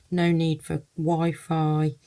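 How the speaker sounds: ordinary delivery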